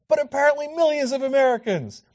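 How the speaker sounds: ordinary delivery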